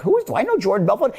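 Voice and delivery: puzzled prospect. Retold or imitated. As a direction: rack your memory in a hurry. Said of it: "they're like".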